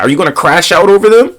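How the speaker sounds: ordinary talk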